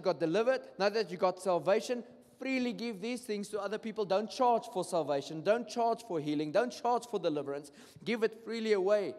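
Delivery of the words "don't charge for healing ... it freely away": ordinary talk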